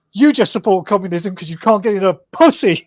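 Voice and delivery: heckling. Retold or imitated. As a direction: call it out at protesters, retold. Imitates man heckling protest stall.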